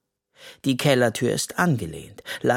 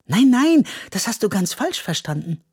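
high, louder rush of reassurance; eager reassurance; 10 semitones higher, 4 dB louder than usual. A narrator's reading. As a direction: hurry to set him straight. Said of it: "sagt Mathilda".

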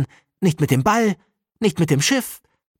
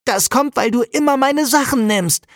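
put-on high, sulky accusing child voice; angry child; 9 semitones higher than usual. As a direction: blame her like a hurt little boy. Boy blames his sister.

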